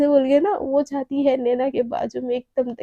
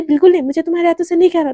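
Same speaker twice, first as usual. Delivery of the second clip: fast sharp refusal; 4 semitones higher, 6 dB louder, faster than usual. Acted. Naina refuses Shivani's feeding.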